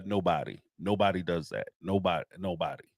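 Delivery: low and soft, trailing away; muttered. Real, not acted. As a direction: let it die off under your breath.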